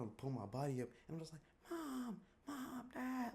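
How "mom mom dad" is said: very high, softer squeaky calling. Retold or imitated. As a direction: call mom faintly in a tiny voice.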